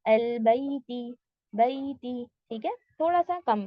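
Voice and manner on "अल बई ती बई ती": अल बई ती slowed, बई a bit under two counts; slightly shortened बई; slow syllable demo. Shown as a demonstration. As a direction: spell out अल बई ती slowly. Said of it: "टू count से थोड़ा कम देना है".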